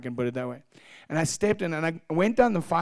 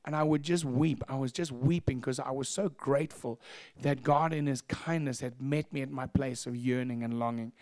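ordinary delivery

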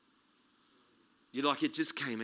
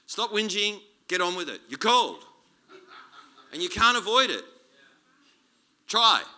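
loud, raised-pitch urging in punchy bursts; forceful exhortation; 6 semitones higher, 9 dB louder than usual. Retold or imitated. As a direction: drive it home like a mentor pushing a pupil. Acts out Paul exhorting Timothy.

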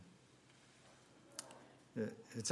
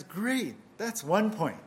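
higher, louder, breezily cheerful verdict; chipper Peter; 4 semitones higher, 5 dB louder, slower than usual. Acted. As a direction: deliver the bad news brightly as Peter. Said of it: "And Peter says".

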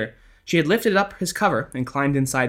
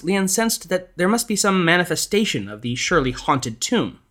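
ordinary delivery

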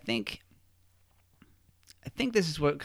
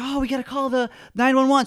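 high, loud alarmed call; mock urgency; 12 semitones higher, 6 dB louder than usual. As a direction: urge everyone to get help, acted. Acting out Frank reacting to collapse.

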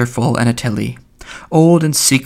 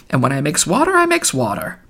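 lightly voiced wheedling old woman; a touch higher, gently wheedling; Mother Grogan; 3 semitones higher than usual. A narrator's reading. Mulligan quoting an old woman, lightly wheedling. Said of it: "in an old woman's wheedling voice".

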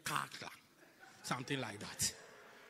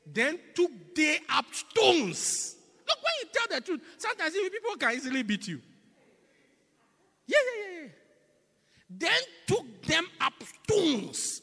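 preaching at full force; 13 semitones higher, 11 dB louder than usual. Acted. Preacher thundering the scripture to the church, loud and high. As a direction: thunder the verse at the congregation.

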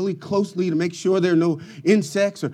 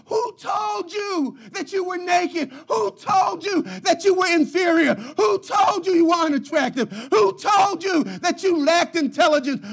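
high, loud, crying-out questions; impassioned preaching; 9 semitones higher, 4 dB louder than usual. Acted. Preacher voicing God's questions to the church, impassioned. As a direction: cry each question out to the congregation.

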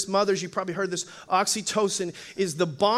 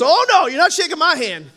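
loud, higher-pitched mock protest; retold indignant refusal; 6 semitones higher, 8 dB louder than usual.

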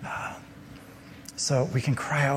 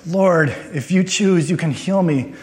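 higher, drawn-out plea to be healed; crying out; 5 semitones higher, 3 dB louder than usual. Acted. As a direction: act out a leper begging for mercy. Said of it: "He is crying out to God".

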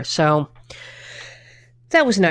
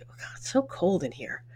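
retold whisper; 11 dB quieter than usual. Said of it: "And I'm like".

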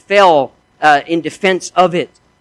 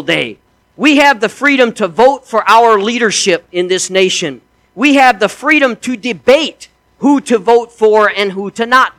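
loud, raised-pitch sermon delivery; preaching at full force; 6 semitones higher, 4 dB louder than usual. Acted. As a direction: preach it out to the congregation with fire.

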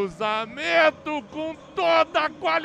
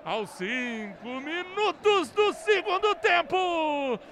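explosive goal commentary; slower than usual. Real, not acted. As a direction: stretch every word with triumph.

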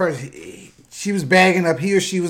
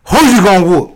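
high then very loud threatening challenge; sassy threat; 5 semitones higher, 10 dB louder than usual. Retold or imitated. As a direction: call her bluff, big and sassy. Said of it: "looked at him and said".

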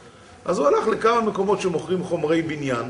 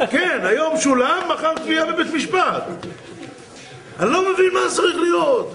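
retold angry yelling; 11 semitones higher than usual. Customer threatening the office clerk, yelling indignantly. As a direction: rant in acted outrage. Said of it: "וצעקות".

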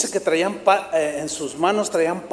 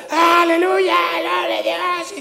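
high, excited shout of praise mid-sermon; imitating a shouted aleluya; 11 semitones higher, slower than usual. A worshipper interrupting the preacher with a high cry. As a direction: mimic a worshipper blurting out praise. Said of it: "alguien empieza a gritar".

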